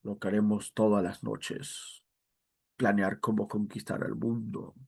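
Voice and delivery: a hint of a cartoon mastermind plotting; quietly plotting world domination, a cartoon genius; Cerebro. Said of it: "Pinky a Cerebro".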